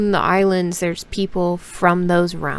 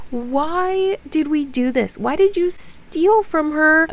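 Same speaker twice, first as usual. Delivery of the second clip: high, slow incredulous whine; high drawn-out why; exasperated whine; 8 semitones higher, slower than usual. Acted. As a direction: plead with the heroine in disbelief. Venting at the book character.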